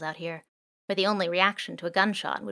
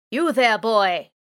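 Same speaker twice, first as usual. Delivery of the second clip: drone calling out; 3 semitones higher, 8 dB louder, much slower than usual. Acted. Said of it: "chimed a third voice".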